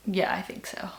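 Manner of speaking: breathy whisper to herself. Real, not acted. Reader muttering page math to herself, whispered. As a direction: whisper it like a private aside. Quiet aside while counting pages.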